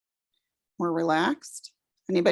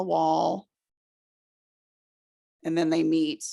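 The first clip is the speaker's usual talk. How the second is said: calm, unhurried breathing-exercise guidance; guided meditation. Acted. Teacher guiding the class through a breathing exercise, calm meditation voice.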